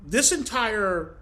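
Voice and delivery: usual speaking voice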